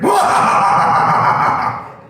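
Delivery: huge shouted villain cackle; anime villain laugh. Pretend. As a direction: belt out a cartoon villain's "Wah!". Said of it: "lets out an anime villain laugh".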